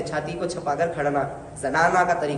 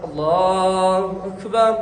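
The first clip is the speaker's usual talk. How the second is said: prayer call demo; slow drawn-out takbir; much slower than usual. Shown as a demonstration. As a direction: stretch Allahu Akbar long and slow. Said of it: "अपनी आवाज़ के साथ साथ रुकन को अदा करना".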